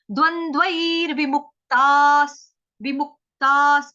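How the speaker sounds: loud, slow, drawn-out recitation. Shown as a demonstration. Teacher models the verse phrase, loud and slow. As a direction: chant the phrase clearly for the class. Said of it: "ऐ के बाद मतलब".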